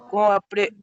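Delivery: normal talking voice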